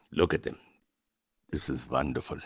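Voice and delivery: normal talking voice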